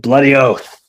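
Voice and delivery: a Canadian trying a jokey Australian accent on "Bloody oath"; mock Australian accent. Shown as a demonstration. Canadian showing an Aussie phrase to the host, in a mock Australian accent. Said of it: "That's a horrible accent".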